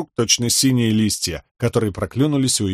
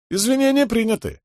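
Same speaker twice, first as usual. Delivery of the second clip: lofty mock Horneater; 11 semitones higher than usual. A narrator's reading. Fake princess accepts.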